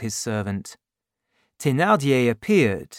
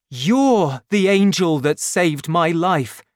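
grateful cry, louder; 4 semitones higher, 6 dB louder than usual. A narrator's reading. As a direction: burst out in gratitude. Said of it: "cried Marius".

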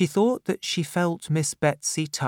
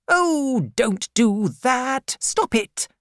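put-on high, startled old lady telling her to stop; a stern aunt flapping at tears; flustered old aunt; 12 semitones higher, 4 dB louder, slower than usual. Aunt Betsy hurriedly stops the crying widow, high and flustered. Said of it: "said Miss Betsy quickly".

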